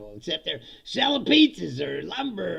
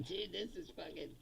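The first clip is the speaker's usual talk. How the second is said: very soft, disbelieving aside; stunned aside; 15 dB quieter than usual. Acted. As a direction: mutter it privately to a friend.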